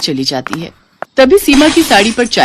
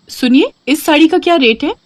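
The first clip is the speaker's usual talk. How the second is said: higher, lightly voiced girl showing off; playful daughter; 9 semitones higher than usual. Daughter showing off sari to mother, higher playful voice. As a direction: ask mom how you look, teasing. Seema asks shopkeeper.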